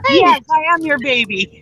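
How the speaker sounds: high-pitched, gushing pet voice; eager dog voice. Acted. Voicing the dog leaping into someone's arms, eager and high.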